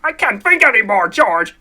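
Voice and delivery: ordinary talk, nothing marked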